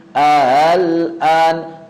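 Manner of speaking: "al-an" begun a-hal, second hamzah softened; tashil. Shown as a demonstration. Teacher showing the second way, hamzah eased by tashil. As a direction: glide through two A sounds into "al-an" without a hard stop. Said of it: "Hamzah wasalnya ditashil".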